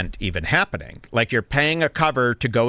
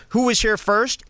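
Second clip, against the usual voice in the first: mock bartender; 10 semitones higher than usual. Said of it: "the bartender goes".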